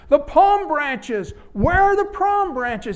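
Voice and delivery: high, loud, slow outraged donkey yell; shouting demand. Acted. Donkey shouts at the marketplace for palm branches, high, loud and slow. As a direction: bellow it as a slighted donkey demanding homage. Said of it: "he shouted".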